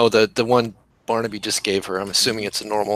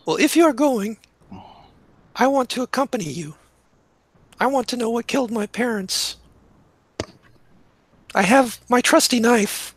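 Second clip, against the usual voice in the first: higher-voiced, determined farm girl; Celia; 13 semitones higher than usual. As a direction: insist on coming along, pitched up and measured. Game master plays farm girl Celia.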